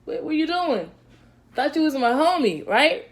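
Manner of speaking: high, casual mock-hurt pleading; hurt buddy asking where his friends went; Jesus as a disappointed buddy. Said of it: "he was asking his boys".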